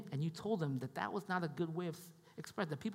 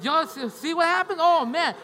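much higher and much louder, crowing over the point; loud excited crowing; 10 semitones higher, 10 dB louder than usual. Real, not acted. Excited aside after comeback.

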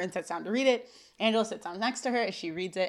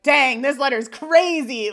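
slow, louder, higher mock amazement; mocking friend; 5 semitones higher, 10 dB louder, slower than usual. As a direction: ham up the nosy friend. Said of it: "best friend be like".

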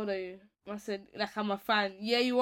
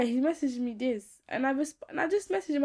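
ordinary talk

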